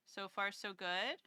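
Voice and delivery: normal talking voice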